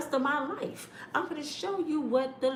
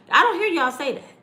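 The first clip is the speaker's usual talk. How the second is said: louder, higher call-out; louder scolding; 5 semitones higher, 7 dB louder than usual. Real, not acted. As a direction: call the viewers out. Scolding audience after whisper.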